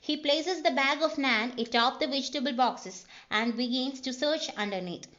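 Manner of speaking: reads each word out slowly, like a dictation; slow script reading. Shown as a demonstration. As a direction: spell out the scene calmly for the class. Reading screenplay aloud slowly.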